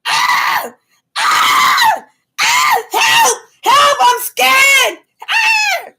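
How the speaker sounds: very high-pitched staged shrieks; loud demonstration of panicked cries; screaming for help. Shown as a demonstration. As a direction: shriek as if terrified for your life. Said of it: "'Cause I will scream like that".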